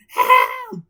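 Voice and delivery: very high loud scream; crying for help. A narrator's reading. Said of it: "cried Krabby Patty Bob".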